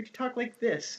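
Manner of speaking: high, softened voice; soft high inflection demo. Shown as a demonstration. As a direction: lift the pitch and soften right down. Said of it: "shape it with inflection".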